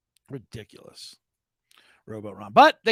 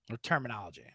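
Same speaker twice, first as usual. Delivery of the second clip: ordinary delivery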